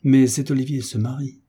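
ordinary delivery, nothing marked

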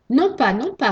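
tearful refusal. A narrator's reading. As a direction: cry out the refusal. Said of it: "répondit Pandore en pleurant".

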